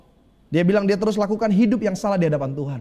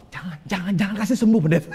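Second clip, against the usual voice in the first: mock wife's plea; faster than usual. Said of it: "Istrinya bilang apa".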